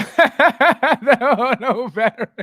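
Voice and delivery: slow words swallowed by loud laughter; cracking up. Real, not acted. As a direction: try to say it through real laughter. Cracks up at viewer's comment.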